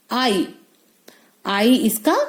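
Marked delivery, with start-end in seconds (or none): 0.1-0.5 आई said as the letter name 'eye'; long आई, as in pine; long I sound. Shown as a demonstration. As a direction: give आई the vowel of 'ride'. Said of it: "long sound है".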